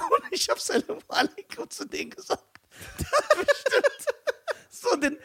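squeezed high through laughter; helpless laughter. Real, not acted. Telling how he greeted the rapper, cracking up laughing. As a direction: barely get the words out for laughing.

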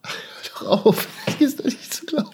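breathless astonished whisper; amazed whisper. Real, not acted. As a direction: whisper it in disbelief. Amazed at backwards trick.

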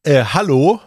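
hesitant, questioning hello; polite opening call; first tentative call. Retold or imitated. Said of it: "Er hat's noch nicht gehört".

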